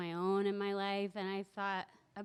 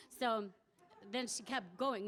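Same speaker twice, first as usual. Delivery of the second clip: ordinary talk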